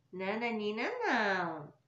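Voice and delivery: normal talking voice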